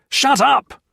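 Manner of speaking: very high, loud scream. A narrator's reading. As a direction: scream him silent. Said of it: "Jones screamed".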